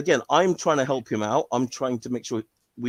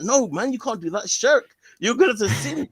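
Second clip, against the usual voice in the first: high, urgent warning; mock alarmed warning; 9 semitones higher, 3 dB louder than usual. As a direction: scold him before he sins. Friend warning against shirk.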